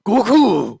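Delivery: loud, high shout of the name; villain's shout. Acted. Transformed Hitler shouts at Goku, loud and high. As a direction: bellow his rival's name from above.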